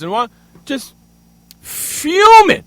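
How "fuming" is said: high, loud burst on one word; fuming. Real, not acted. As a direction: burst out with it, fed up. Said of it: "I was completely pissed off".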